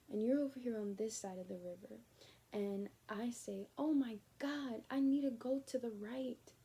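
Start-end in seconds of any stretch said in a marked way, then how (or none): 3.8-6.5 higher and louder, slow dramatic gasp; mock excited. Acting out herself at the river, mock excited. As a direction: play up the sudden realization.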